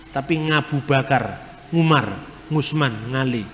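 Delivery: Javanese way of saying the names, Ain turned into "Ng"; Javanese accent. Shown as a demonstration. Lecturer imitating Javanese speakers who cannot say Ain, Javanese accent. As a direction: say "Ngabu Bakar, Ngusman, Ngali" as a Javanese speaker would, with a nasal ng for Ain. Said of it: "Orang Jawa tidak bisa ngomong huruf Ain".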